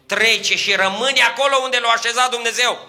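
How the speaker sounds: preacher thundering to the congregation about tears; preaching at full force. Acted. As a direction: lift the church with a ringing sermon. Preacher climaxes.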